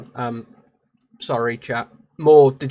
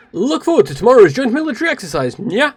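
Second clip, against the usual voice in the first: higher, louder, upbeat game heroine; eager game character; 7 semitones higher, 6 dB louder than usual. Acted. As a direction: voice an eager character looking forward to tomorrow.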